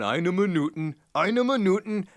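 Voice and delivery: high, loud mock German accent. Old Hitler-like man hurrying to his car phone, loud mock German accent. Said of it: "he's going".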